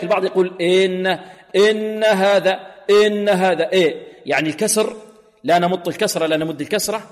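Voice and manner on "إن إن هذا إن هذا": "إن" with its i opened toward e, loud; weakened kasra. Shown as a demonstration. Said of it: "مع المحافظة على الكسر". ("إن" بكسرة غير محققة)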